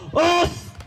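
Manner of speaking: high call of "おーっす" to the audience; crowd call. Acted. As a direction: lead the crowd's greeting. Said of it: "会場に集まった人間たちよ".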